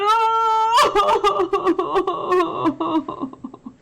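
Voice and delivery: high, loud fake wailing; hammed-up blubbering sob. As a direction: wail like a sobbing bear. Fake crying laugh.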